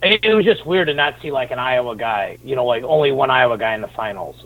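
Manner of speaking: ordinary talk, nothing marked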